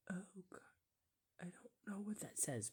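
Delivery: soft disgusted whisper. Real, not acted. A viewer grossed out by the ads, whispering softly. Whispering while watching clips.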